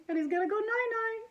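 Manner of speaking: high, sing-it-out bedtime voice; cutesy baby talk. Pretend. Reader describing the principal tucked in with teddy, cutesy baby talk. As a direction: coo it playfully, high up.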